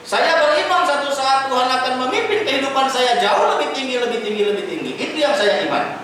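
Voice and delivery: high and loud, climbing then easing on lebih tinggi; fervent preaching. Acted. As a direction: build the faith claim, then let it settle. (khotbah penuh semangat, naik lalu mereda)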